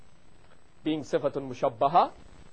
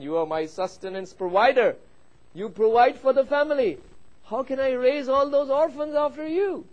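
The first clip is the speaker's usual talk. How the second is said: distraught wife; 10 semitones higher, 6 dB louder than usual. Retold or imitated. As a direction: plead higher and louder, pitch climbing to the end. Wife wailing at dying husband.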